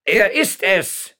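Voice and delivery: slow, high shout of alarm; loud, high-pitched official's yell. A narrator's reading. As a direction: cry it out at full voice, stretched. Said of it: "schrie der Ortsvorsteher".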